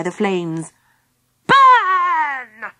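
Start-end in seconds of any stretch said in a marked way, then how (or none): 1.5-2.7 villain's high, gleeful scream; high, drawn-out cry of evil glee; delighted scream. A narrator's reading. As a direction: shriek it loud and long with wicked delight. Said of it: "she screamed in delight".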